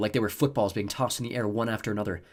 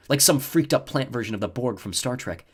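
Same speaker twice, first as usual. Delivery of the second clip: usual speaking voice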